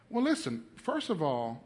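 usual speaking voice

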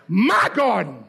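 "My" swelled and punched, "garden" falling; firm, drawn-out "My" before a falling "garden"; emphatic demonstration. Shown as a demonstration. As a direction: show them how, leaning hard on "My". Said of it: "y'all can't even do it right".

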